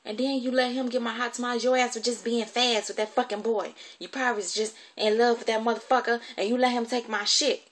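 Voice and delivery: going off. Retold or imitated. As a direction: imitate an irate guardian accusing a child. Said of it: "she really went off then".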